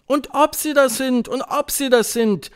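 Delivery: high, loud chanted agreement; witches' chorus. A narrator's reading. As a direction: echo it like an eager chorus. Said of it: "antwortete der Chor der englischen Hexen". (Hexenchor, laut und hoch zustimmend)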